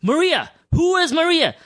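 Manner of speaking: high, urgent calling for Maria. Retold or imitated. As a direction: call out her name, searching.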